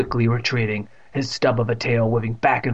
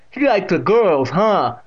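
high, loud, drawn-out teasing; teasing great-aunt; 7 semitones higher, 5 dB louder, slower than usual. A narrator's reading. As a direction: tease him as the amused deaf aunt. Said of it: "still chuckling".

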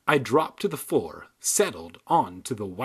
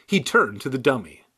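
ordinary delivery

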